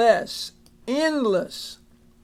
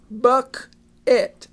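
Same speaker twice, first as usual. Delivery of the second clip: bucket said slowly and carefully; slow down on bucket, a tricky one; teacher slowing for a tricky word; slow careful demo; 3 semitones higher, 4 dB louder than usual. Shown as a demonstration. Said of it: "kind of a tricky one".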